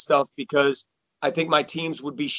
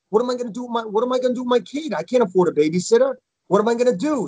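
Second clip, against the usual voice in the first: worried parent; 7 semitones higher, much faster than usual. Retold or imitated.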